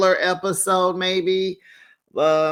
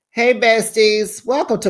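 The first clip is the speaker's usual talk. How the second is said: loud intro greeting; 7 semitones higher, 6 dB louder than usual. Real, not acted. Channel intro greeting, second take.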